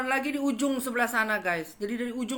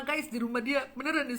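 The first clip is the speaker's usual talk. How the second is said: normal talking voice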